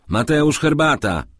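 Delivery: high, loud call for tea; calling shout. A narrator's reading. Master shouts to the servant coming in, calling shout. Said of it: "krzyknął do wchodzącego lokaja".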